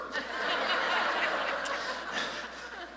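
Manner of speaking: airy giggle breaking up high; high breathless laugh. Real, not acted. Pastor laughing at own joke to congregation: airy high giggle.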